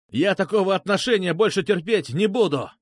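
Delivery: high-pitched, restrained yell; indignant yelling. A narrator's reading. Petrushka refuses to take such treatment, indignant yell. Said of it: "заорал Петрушка".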